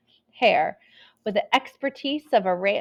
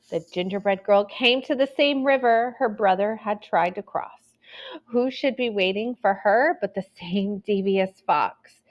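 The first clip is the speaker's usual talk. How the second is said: ordinary delivery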